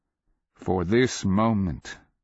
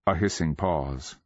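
ordinary delivery, nothing marked

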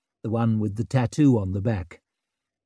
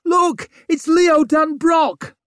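excited shout; 5 dB louder, slower than usual. A narrator's reading. Crowd members shouting.

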